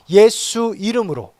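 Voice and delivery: 예수를 세게 외치며 느리게 선창; slow, loud call for the congregation to echo; call to repeat. Shown as a demonstration. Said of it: "우리 따라해 봅시다". (회중에게 따라하라고 크게 선창)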